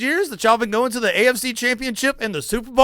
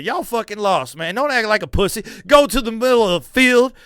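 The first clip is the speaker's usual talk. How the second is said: usual speaking voice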